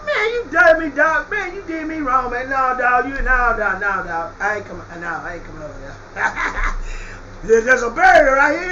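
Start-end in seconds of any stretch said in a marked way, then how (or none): 0.0-6.7 rich man begging in mock tears; mock whining plea. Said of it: "it was some Negro".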